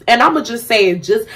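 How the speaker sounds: ordinary talk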